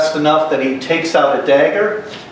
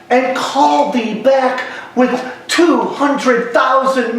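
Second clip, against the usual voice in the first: slow, high-pitched theatrical Shakespearean declamation; impassioned stage friar, drawn-out phrases rising in pitch; stern Friar Lawrence; 7 semitones higher than usual. Acted. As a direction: scold a despairing young man, then counsel him, in measured stage verse. Acts Friar Lawrence's rebuke of Romeo.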